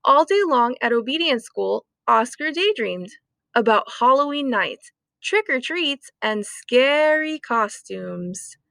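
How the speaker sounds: ordinary delivery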